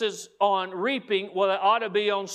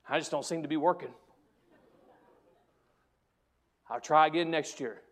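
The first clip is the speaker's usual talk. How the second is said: mock discouraged sigh; 6 semitones lower, 5 dB quieter, faster than usual. Dieter at the mirror after failing, deflated and mock-discouraged. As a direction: lower and softer, a deflated dieter.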